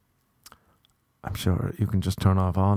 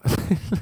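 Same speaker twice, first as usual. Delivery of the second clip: short breathy laugh; 10 semitones higher than usual. Real, not acted. Laughs at the story.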